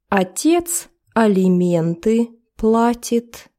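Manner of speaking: ordinary talk, nothing marked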